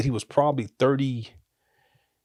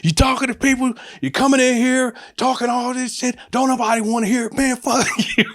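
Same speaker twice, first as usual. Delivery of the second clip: going off; 12 semitones higher than usual. Retold or imitated. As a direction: tell the boss off with everything you've got. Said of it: "and I went off".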